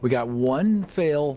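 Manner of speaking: normal talking voice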